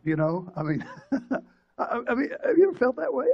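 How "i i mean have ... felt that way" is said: laughing. Real, not acted. Pastor laughs at his dark joke.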